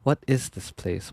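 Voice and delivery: normal talking voice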